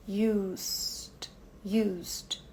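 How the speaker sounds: used alongando o S antes do D; "Used" com S comprido; S longo. Shown as a demonstration. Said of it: "do S, que é longo". ("Used" com S longo e D curto)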